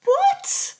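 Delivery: shocked what. Retold or imitated. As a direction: squeak it out in disbelief. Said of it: "And I'm like".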